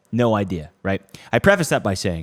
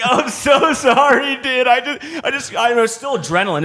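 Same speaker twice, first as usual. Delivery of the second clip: adrenaline apology; 12 semitones higher, 5 dB louder than usual. Retold or imitated. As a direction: say sorry with adrenaline still racing. Apologizing, still pumped.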